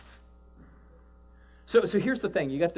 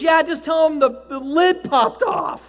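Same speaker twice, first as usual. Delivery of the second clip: laughing reply; 12 semitones higher, 11 dB louder, slower than usual. Retold or imitated.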